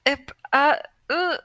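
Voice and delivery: ordinary talk, nothing marked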